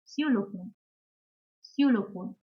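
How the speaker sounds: "xylophone" as ksü-lo-FOHN; German example word. Shown as a demonstration. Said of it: "For example".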